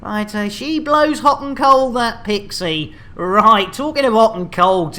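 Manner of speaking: ordinary delivery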